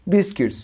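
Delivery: বিস্কিটস with short "-kits" ending; corrected biscuits. Shown as a demonstration. Teacher repeating the right form for learners, corrected biscuits. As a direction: stress the first syllable of বিস্কিটস, then a light "kits". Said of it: "ওটা উচ্চারণটা হবে কি?".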